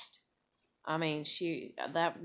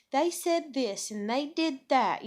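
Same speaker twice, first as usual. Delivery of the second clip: put-on higher, louder little-girl voice; little tiny voice; 7 semitones higher, 4 dB louder than usual. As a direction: mimic a small child blurting out what happened. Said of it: "she's got this little tiny voice".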